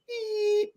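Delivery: high thin beep sound; imitated beep. Shown as a demonstration. Trainer mimicking the bark collar, high beep. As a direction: squeak out a tiny collar beep. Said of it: "you hear a little beep".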